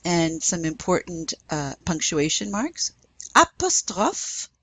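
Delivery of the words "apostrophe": "Apostrophe" in French, slow and high; high, drawn-out French "Apostrophe" for learners to repeat; French pronunciation model. Shown as a demonstration. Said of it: "repeat after me".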